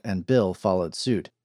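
usual speaking voice

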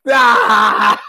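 open-throated "ah" bursting into laughter; loud mocking laugh. Man laughs at the stranger challenging him, loud guffaw. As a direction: laugh right in his face, loud. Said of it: "I'm just going".

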